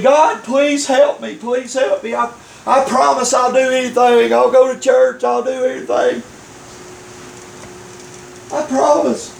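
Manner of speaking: high-pitched mock begging; desperate pleading. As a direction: beg and bargain for rescue, hammed up. Man begs God for rescue.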